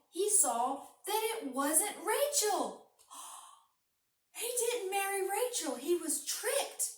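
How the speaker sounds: put-on a storyteller wowing kids with the twist; shocked reveal. A narrator's reading. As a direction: gasp at the trick.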